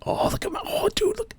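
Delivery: soft whisper with a pitch jump; whispering delight at new loot; excited whisper. Real, not acted. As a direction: hush your delight at the loot.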